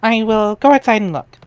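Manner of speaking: put-on perky girl reading her chat lines up high; Jade's chat voice.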